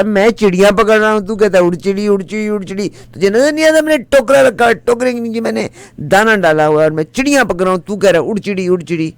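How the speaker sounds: angry bird-catcher yelling at the fool; angry scolding. Acted. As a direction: rip into him for scaring the birds.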